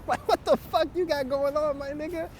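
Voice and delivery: high, alarmed questioning of a friend; mock horrified friend asking what's wrong; incredulous disbelief. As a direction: confront him in high-pitched shock. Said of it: "I'm like".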